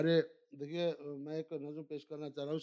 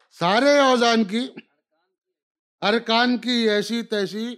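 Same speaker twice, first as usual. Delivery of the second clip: measured, declaimed verse lines; stately nazm recitation; 6 semitones higher, 13 dB louder, slower than usual. Acted. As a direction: recite each line, then repeat it louder. Recites nazm Aisi Taisi.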